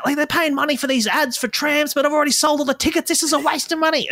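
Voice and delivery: high-pitched, monotone ranting comedian impression; Dave Hughes. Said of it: "Do the voice".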